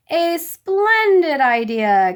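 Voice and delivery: high, loud, slow delighted king; delighted reply. A narrator's reading. King Tub replying to the lords, loud and delighted. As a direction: savour the good news. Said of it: "King Tub had replied".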